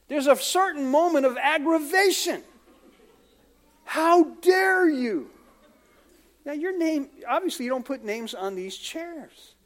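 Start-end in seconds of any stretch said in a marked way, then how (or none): 3.8-5.3 slow, drawn-out outraged huff; a hammed-up churchgoer scandalized over a pew; mock indignation. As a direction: act offended that someone took your seat. Regular finds visitor in pew.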